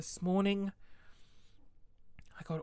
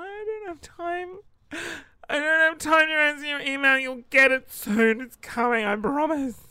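frantic mock wail; 9 semitones higher, 10 dB louder than usual. Mock frantic reply to customer emails.

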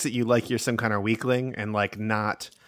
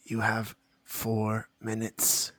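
ominous warning; slower than usual. Acted. Warning about the orphanage deadline.

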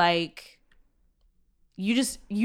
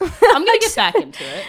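higher and louder, slow, through laughter; laughing mock quote; 12 semitones higher, 11 dB louder than usual. Teasing friend mimics her TV-comeback vow, laughing. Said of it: "I like how you're like".

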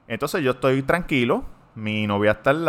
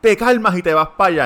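loud, firm, higher mock order; imitated policewoman's command; stern policewoman; 7 semitones higher, 7 dB louder than usual. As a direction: bark calm down like a cop. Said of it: "la mujer policía".

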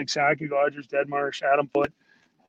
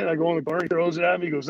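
ordinary talk, nothing marked

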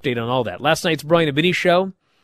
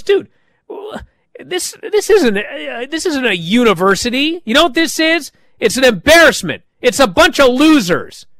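much higher, loud disgusted rant; angry rant; 10 semitones higher, 5 dB louder than usual. Retold or imitated. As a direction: berate the team and storm off. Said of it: "And he storms off".

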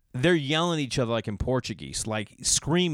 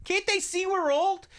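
high, squeaky mock old man complaining; mock old men yelling at each other; cranky old man. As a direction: imitate the old guys griping while lifting. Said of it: "going nuts".

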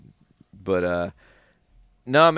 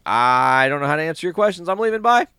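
nervous exit; 4 semitones higher, 5 dB louder, faster than usual. Retold or imitated. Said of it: "Nervously, Ben just dipped".

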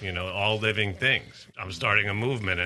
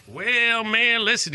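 high, louder put-on Virginia accent; Keith Allen Harward's Virginia accent; 14 semitones higher, 5 dB louder, much slower than usual. Exoneree answering how he stayed sane, imitated Virginia accent. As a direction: launch high into a Virginia impression. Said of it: "I can do his accent pretty well".

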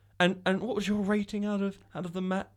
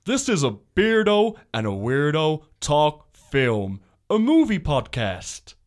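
slow, loud movie trailer read; 2 semitones lower, 5 dB louder, slower than usual. Pretend. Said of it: "should I do my movie voice?".

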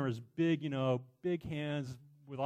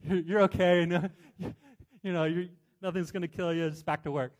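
imitating dad; 4 semitones higher, 8 dB louder than usual. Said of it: "That's my dad".

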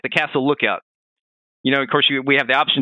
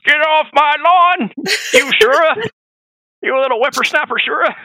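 put-on old prospector; 13 semitones higher, 6 dB louder than usual. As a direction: shake your fist like a grumpy old man. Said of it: "Old Man Ishin".

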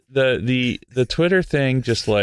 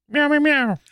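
high cat meows; playful meowing; slower than usual. Pretend. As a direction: meow like a cat. Meowing in the intro.

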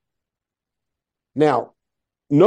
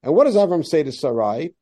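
ordinary delivery

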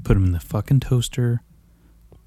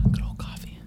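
breathy aside to his coffee; soft whispered sigh to himself; 9 dB quieter than usual. Real, not acted.

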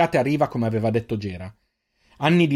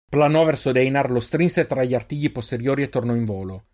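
usual speaking voice

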